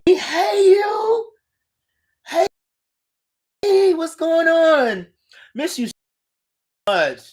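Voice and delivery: high, loud delighted hello; excited greeting. Real, not acted. Host greets a former student in the chat, delighted.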